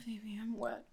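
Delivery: usual speaking voice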